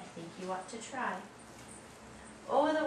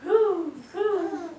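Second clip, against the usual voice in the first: high loud hooting; owl hoot; 8 semitones higher, 11 dB louder than usual. Acted. Owl sound.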